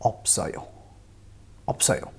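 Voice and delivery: teacher modelling ऑप्सोयो; pronunciation demo. Shown as a demonstration. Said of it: "इसका pronunciation हो जाएगा".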